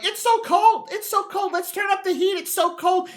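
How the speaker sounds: high whiny mock of a cold girlfriend; mocking complaining woman. Man mocking women who always feel cold, high whiny mimic. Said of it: "they're like".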